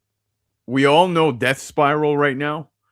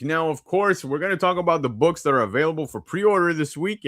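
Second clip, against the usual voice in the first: put-on booming kids show opener; 5 semitones higher than usual. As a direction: announce it like a TV host. Opens segment like show host.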